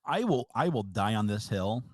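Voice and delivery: usual speaking voice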